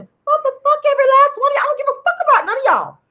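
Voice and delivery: high, fast angry outburst; furious rant. Real, not acted. Cursing out every viewer at once, furious and high. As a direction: spit it out at everyone.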